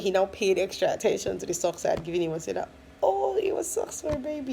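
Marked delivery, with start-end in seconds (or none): 3.0-4.5 higher dawning surprise; imitating husband's surprised discovery; husband's realization. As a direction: let it dawn on him. Husband realizes socks' meaning.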